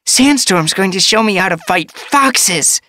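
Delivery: very high, bouncy kitten voice; excited young apprentice. A narrator's reading. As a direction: chirp it eagerly, ready to run.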